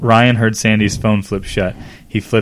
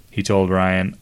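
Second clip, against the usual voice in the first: usual speaking voice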